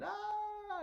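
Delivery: high, sustained mock scream; hammed-up high-pitched yell; crazed scream. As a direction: wail like a madman. Crazed man bursts from graves.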